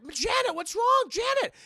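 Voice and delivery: very high, loud, drawn-out cry; hammed-up frightened girl; alarmed sister. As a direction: call out in mock panic.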